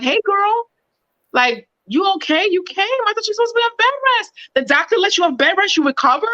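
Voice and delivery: high, fast sugary mock concern; fake concerned friend. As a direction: sweetly needle her with fake worry. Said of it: "I would've been like".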